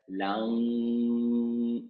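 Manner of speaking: "Lang" held on a long ng, mouth open; tongue-pushed Lang. Shown as a demonstration. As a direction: push the tongue up and sustain the ng in "Lang". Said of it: "the tongue is pushing that".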